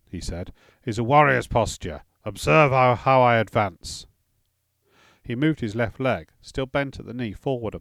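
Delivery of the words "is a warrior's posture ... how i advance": commanding instructor, slowing at the end; firm drill teacher showing the stance; stern old warrior. A narrator's reading. Grettir demonstrates the posture.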